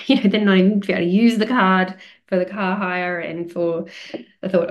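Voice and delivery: ordinary talk, nothing marked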